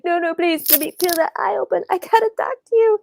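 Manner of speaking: high, fast, desperate plea; pleading. Retold or imitated. Mother begging her deaf daughter to look, high and pleading. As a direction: beg her to open her eyes, rushing the words.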